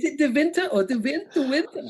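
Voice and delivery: high, questioning mock German; trying out the name in a German accent. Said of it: "in a German accent".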